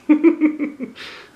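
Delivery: a bright, high burst of laughter; high delighted laugh; high giggle. Real, not acted. Reader laughs at the bear.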